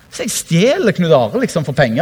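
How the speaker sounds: normal talking voice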